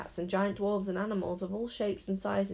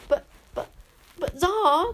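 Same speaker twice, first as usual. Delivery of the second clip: much louder, higher panicked stammering; flustered stammer; 10 semitones higher, 8 dB louder, slower than usual. A narrator's reading. Raven panicking at the boy's plan, flustered stammer. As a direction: splutter in alarm, tripping over words. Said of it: "grabbled poor Caliburn".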